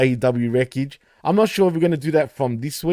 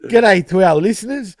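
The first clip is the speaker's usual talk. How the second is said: higher Ocker Australian greeting; broad Aussie; 4 semitones higher, 5 dB louder than usual. Shown as a demonstration. Co-host greeting listeners on demand, broad mock Aussie. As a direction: greet them in hammed-up Aussie. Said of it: "I can do Aussie if you really want me to".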